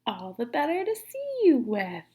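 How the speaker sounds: breathy put-on wobbly granny voice; wolf still faking grandma. Wolf answering the girl as fake Grandma, wobbly voice. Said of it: "in the same wobbly voice".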